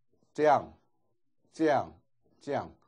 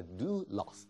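"do" rises high and falls before "loss"; high-low accent; 5 semitones lower, 9 dB quieter than usual. Shown as a demonstration. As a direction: give DO a high-to-low curve, then a low "loss". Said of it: "高低一点的". (do读得有高有低)

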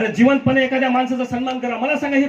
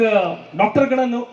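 ordinary talk

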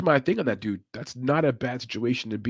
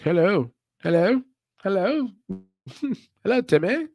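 high-pitched, hammed-up English accent; mock English accent; 8 semitones higher than usual. Commentator imitating the fighter's accent, mock English accent. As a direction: put on a high English "hello" with lifted endings. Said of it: "He's got that kind of accent".

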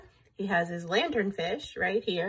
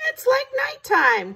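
very high, loud little cry; urchin crying out; 5 dB louder than usual. A narrator's reading. Urchin crying out in the dark seaweed, high squeaky cry. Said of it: "cried the sea urchin".

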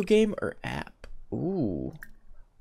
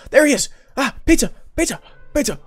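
high, excited cries; overjoyed; 7 semitones higher, 5 dB louder, much faster than usual. Real, not acted. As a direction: greet a long-awaited arrival with breathless glee. Long-awaited pizza man finally arrives.

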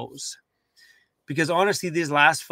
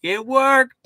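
higher and louder, triumphant; excited cheer; 9 semitones higher, 5 dB louder than usual. Retold or imitated. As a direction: burst out in triumph. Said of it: "when it cranked over and going".

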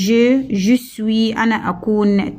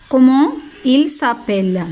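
Comment il s'appelle asked slowly, a bit higher; slow model question; 3 semitones higher, much slower than usual. Shown as a demonstration. Teacher to pupils, modelling the question slowly. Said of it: "teacher models question".

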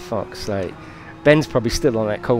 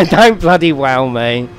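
loud exclaim; 3 semitones higher, 10 dB louder, slower than usual. Real, not acted. Reacting loudly, laughing.

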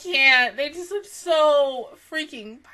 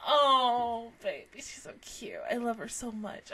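normal talking voice

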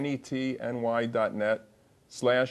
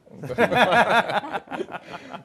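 laughing; 7 semitones higher, 6 dB louder than usual. Real, not acted. Host laughing at joke.

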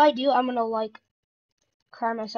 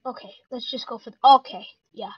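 normal talking voice

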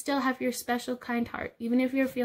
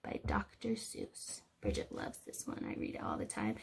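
soft unvoiced whisper to the class; 14 semitones lower, 13 dB quieter than usual. Real, not acted. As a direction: lean in and whisper it. Teacher whispering aside to kids.

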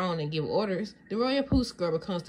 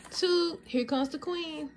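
fanfare announcement; 9 semitones higher, slower than usual. Acted. Announcing the new queen.

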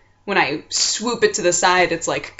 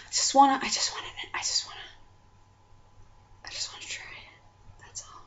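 whispering; 7 semitones higher, 9 dB quieter than usual. Real, not acted. Whispering her wish.

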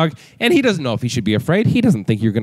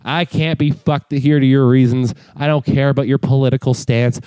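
ordinary talk

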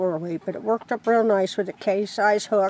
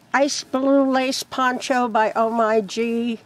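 ordinary talk

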